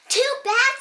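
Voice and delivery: high, loud taunt; taunting shout. Acted. Amanda taunting Jeremiah, high loud shout. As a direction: fling it back defiantly. Kids fighting over paint.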